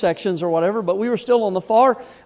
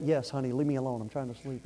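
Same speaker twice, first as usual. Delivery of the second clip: a hint of a drowsy wife, much softer; wife asked to let her sleep, soft and drowsy; sleepy wife; 8 semitones lower, 13 dB quieter than usual. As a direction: mumble it like a half-asleep wife. Wife woken at night.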